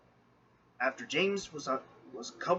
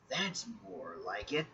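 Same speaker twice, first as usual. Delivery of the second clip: softer, murmured to himself; 3 semitones lower, 7 dB quieter than usual. Acted. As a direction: say it quietly to yourself. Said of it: "James said to himself".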